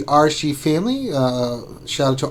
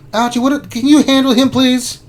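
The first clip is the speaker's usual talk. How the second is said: much higher, begging squeak; high pleading voice; 11 semitones higher, 5 dB louder than usual. Acted. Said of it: "high pleading voice".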